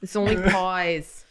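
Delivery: slow, drawn-out Long Island "only pies"; mock Long Island drunk girl; Long Island accent. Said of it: "thickest Long Island accent".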